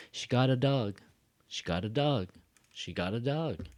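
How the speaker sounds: "dog" falling at the end each time; regular statement. Shown as a demonstration. Teacher models a statement for learners, falling tune. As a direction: state it simply, closing downward on "dog". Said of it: "just a regular sentence".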